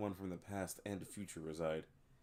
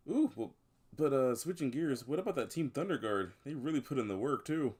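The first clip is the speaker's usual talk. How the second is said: higher, eager fan chatter; keen gossip in a raised voice; enthusiastic bystander; 6 semitones higher, 6 dB louder than usual. Acted. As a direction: bring up a favorite team, pitched up. Bystander switches topic with enthusiasm.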